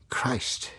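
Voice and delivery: breathless whispered oath; a startled curse under the breath; shocked whisper. Acted. Man staring at the research ship, whispering in shock.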